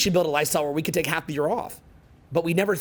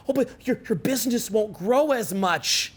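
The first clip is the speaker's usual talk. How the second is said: higher, mocking voice of a doubter; mock naysayer; 6 semitones higher than usual. To the audience, mimicking a critic: higher mock doubt.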